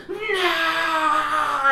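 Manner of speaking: high, loud hammed-up wail; anguished villain cry. GM voicing the alien wailing over his lost golden hair. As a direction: cry out in despair as the hair falls. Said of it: "He says".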